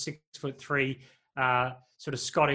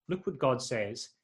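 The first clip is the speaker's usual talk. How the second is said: ordinary talk, nothing marked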